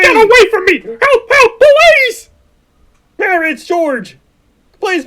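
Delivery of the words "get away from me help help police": higher, louder panicked screams for help; terrified screaming. Acted. Mary fleeing the stranger grabbing her, terrified screams.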